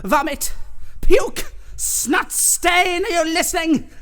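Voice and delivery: high, loud bursts of disgust; furious insults. Acted. Headteacher abusing a pupil before the class, furious insults. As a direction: hurl each insult like a slap.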